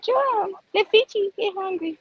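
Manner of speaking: high, playful fish voice. Pretend. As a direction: give the fish a squeaky, silly voice. Voicing the fish.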